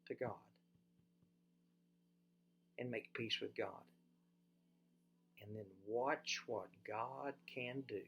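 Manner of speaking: soft, low, tender invitation; gentle closing appeal. Real, not acted. Preacher closing the sermon with a soft low appeal. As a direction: draw them in quietly.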